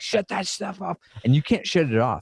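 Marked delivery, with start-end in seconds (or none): 0.0-1.0 wife's irritated order. Retold or imitated. Wife annoyed at 3am alerts.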